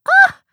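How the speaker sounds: loud, very high gasp; shocked gasp. Acted. Beth hears when he proposed, gasping in shock. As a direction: gasp sharply in surprise. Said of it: "Beth gasped".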